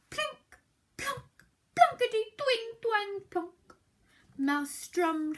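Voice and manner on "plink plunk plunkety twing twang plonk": banjo plinks. A narrator's reading. Banjo noises read playfully.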